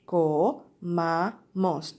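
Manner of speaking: clap-along count of each syllable in "comamos"; "comamos" in three separate, drawn-out beats; split into syllables. Shown as a demonstration. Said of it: "Tres sílabas".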